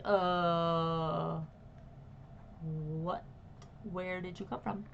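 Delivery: low, halting bewilderment; stunned guard, groping for words; guard in awe. Acted. Guard gawks at people who just appeared, dumbfounded. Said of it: "kind of, like, in awe".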